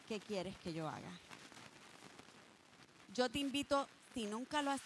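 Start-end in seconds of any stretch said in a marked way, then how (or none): 0.1-1.1 hushed prayer. Shown as a demonstration. Preacher voices a listener's prayer.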